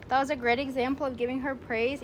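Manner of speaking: normal talking voice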